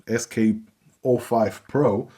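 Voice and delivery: normal talking voice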